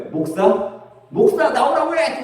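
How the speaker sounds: mock drunk yell, leaping high; demanding drunkard. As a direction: question first, then a high comic demand. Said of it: "이 사람이 또 뭐라고 하겠어요?".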